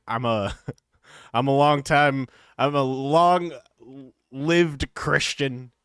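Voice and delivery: loud, half-laughing mock rant; mock Alex Jones yell. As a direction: bellow it back like the ranting host, cracking up. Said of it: "How do you go from, like".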